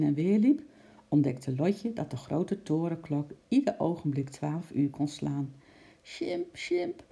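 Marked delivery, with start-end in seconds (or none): 6.0-7.0 high, urgent call. Acted. Lotje calls up to Sjimp, high and urgent. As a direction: call up to the tower. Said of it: "riep ze uit alle macht".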